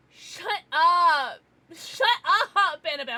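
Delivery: loud mock-outraged shout; playful shout. Real, not acted. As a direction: yell it at a teasing friend. Joking yell at chatter.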